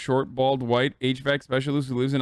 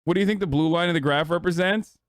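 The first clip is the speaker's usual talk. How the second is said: usual speaking voice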